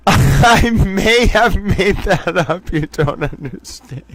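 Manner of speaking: talking through real laughter, fading out; helpless laugh, voice giving way; laughing to tears. Real, not acted. Host admits making up a phrase, laughing to tears. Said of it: "I'm crying. Te-, towel tears of laughter".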